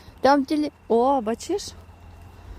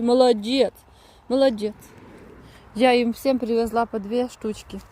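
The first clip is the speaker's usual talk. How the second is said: ordinary talk, nothing marked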